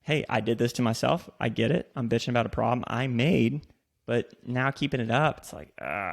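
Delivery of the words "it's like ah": low soft defeated ah; exasperated groan. Real, not acted. Host groaning about lawn upkeep, low and soft. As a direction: let a tired groan out.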